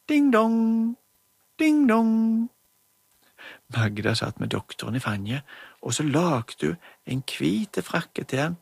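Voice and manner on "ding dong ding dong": high, sing-song doorbell chime; doorbell ding dong. Acted. Children imitate the doorbell, high sing-song chime.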